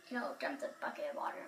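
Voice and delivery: fast, whispered aside; whispering. Real, not acted. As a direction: whisper it quickly. Aside about the water dump.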